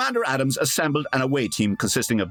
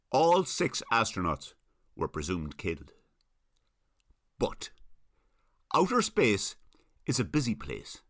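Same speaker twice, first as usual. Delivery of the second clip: soft dramatic trailer read; a hushed movie-trailer build; trailer voiceover; 4 semitones lower, 4 dB quieter than usual. Acted. Host reading a podcast promo, hushed and dramatic.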